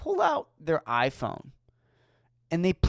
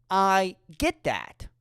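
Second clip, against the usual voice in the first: ordinary delivery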